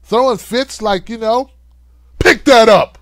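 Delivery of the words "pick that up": loud, harsh order; mock furious yell. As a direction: yell at the staffer. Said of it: "yelling and cussing and throwing stuff".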